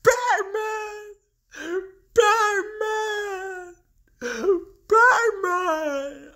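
put-on high, wailing child's cry of Batman; mock cry for help. Joker imitating Robin crying out for Batman. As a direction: wail the name like a scared kid. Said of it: "He was yelling".

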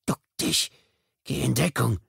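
tense half-whispered order to himself; urgent inner command. Acted. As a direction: hiss a warning inside your own head. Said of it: "Er gab sich Befehle".

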